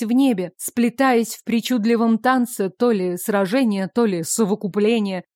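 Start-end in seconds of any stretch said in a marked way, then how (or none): none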